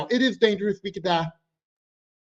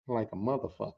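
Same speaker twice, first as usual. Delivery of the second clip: low, soft muttered aside; quiet aside; 10 semitones lower, 10 dB quieter than usual. Real, not acted. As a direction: drop it under your breath. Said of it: "aside punchline".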